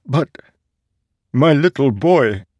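put-on a father crying out over his little boy; anguished father. A narrator's reading. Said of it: "Father said".

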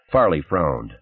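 usual speaking voice

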